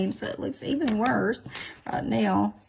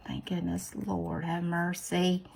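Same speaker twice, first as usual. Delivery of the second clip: normal talking voice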